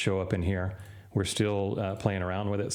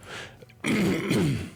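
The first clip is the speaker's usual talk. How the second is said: throat clearing; 11 semitones higher than usual. Real, not acted. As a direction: clear a hoarse throat hard. Clears throat between sentences.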